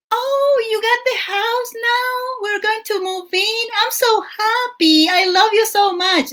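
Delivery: very high, giddy mock-lovestruck voice; gushing partner. Partner on the phone telling her about the house, high giddy gushing. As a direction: squeal it like an oblivious, overjoyed lover. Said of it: "He's like".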